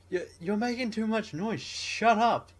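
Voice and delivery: high, loud yelling complaint; mock angry neighbour. Upstairs neighbour to the flat below, mock shout. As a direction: yell down at the noisy flat. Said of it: "their neighbour upstairs is like".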